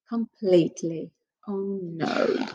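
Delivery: plain reading voice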